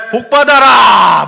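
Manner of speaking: loud, drawn-out slow shout; shouted blessing. Shown as a demonstration. Preacher demos shouting a blessing, then declines, loud and slow. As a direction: bellow the blessing over the crowd. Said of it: "제가 막 소리를 막 이제 지를 수도".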